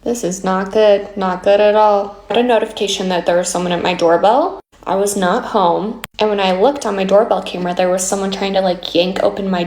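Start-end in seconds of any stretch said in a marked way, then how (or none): none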